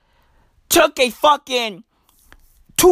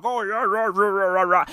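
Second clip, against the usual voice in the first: lightly mocking, softer mumbled gibberish; mock Boris Johnson; 3 semitones lower, 4 dB quieter than usual. Host mimicking Boris in the video, mumbled mock blather. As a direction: blur the words into dismissive rah-rah noise. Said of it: "Boris Johnson is out like".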